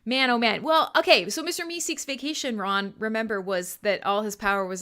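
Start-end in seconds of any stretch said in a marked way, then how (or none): none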